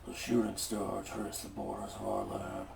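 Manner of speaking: low rasp like gargling sand. Acted. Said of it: "like, gargling sand voice I just did".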